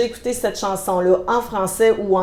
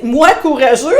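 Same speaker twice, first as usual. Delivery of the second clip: incredulous, raised voice breaking into laughter; mock disbelief; 3 semitones higher, 6 dB louder than usual. Gideon answering the angel who calls him valiant, laughing disbelief. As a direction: scoff at the idea and laugh it off. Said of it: "notre petit emoji de là".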